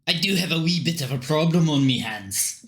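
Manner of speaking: measured Scottish brogue; Scottish accent. Acted. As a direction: confide a problem in a Scottish accent. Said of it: "Don't know why he's Scottish".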